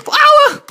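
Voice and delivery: high, loud "whoa"; real shriek of alarm in the game; startled yell. Real, not acted. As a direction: yelp as the hit lands. Startled while failing to dodge.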